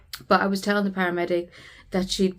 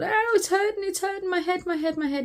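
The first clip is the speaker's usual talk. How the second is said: mock child's wail, much higher; imitating a screaming child; 12 semitones higher than usual. Mother imitating her daughter's cries of pain, a high mock wail. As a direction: pitch right up and wail it like a hurting little girl. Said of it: "she's going".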